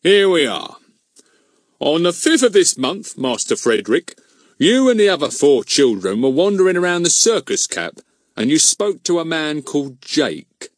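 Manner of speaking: loud, self-important constable; pompous policeman. A narrator's reading. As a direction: read out like a pompous bobby. Goon reads from notebook.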